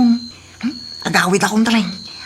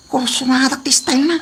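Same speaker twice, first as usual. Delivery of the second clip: ordinary delivery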